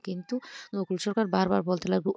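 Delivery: usual speaking voice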